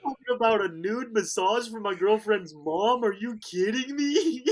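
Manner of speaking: high, laughing incredulous rant; laughing disbelief. Real, not acted. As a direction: say it still cracking up. Disbelief at the offer.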